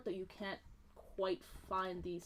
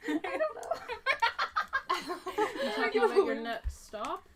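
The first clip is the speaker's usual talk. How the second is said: laughing; 13 semitones higher, 9 dB louder than usual. Real, not acted. Laughing through the line.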